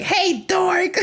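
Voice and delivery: put-on high, sing-song kid taunt tossed down from above; mock a giggly little kid calling names. Little kid taunting tourists from upstairs, high sing-song. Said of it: "I would just randomly be like".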